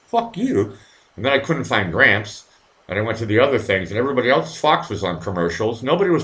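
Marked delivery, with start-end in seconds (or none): 0.1-0.7 high pitched mock curse; mock retort. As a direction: toss it up high at the TV. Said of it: "And I'm like".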